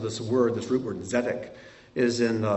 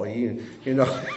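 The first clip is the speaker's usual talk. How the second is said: laughing; 3 dB louder than usual. Real, not acted. Laughs at weeble wobble.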